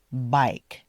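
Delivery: Bike with a full long I glide. Shown as a demonstration. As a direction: stretch the I in Bike. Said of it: "pronounce the long I correctly".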